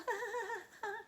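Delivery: high nervous fake giggle; forced weak giggle. A narrator's reading. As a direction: giggle weakly to hide fear. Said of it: "Grover forced a weak giggle".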